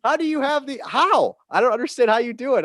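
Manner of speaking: retelling his own stunned reaction, voice jumping up; baffled disbelief. Retold or imitated. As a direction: ask it as if you truly can't fathom it. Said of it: "I'm like".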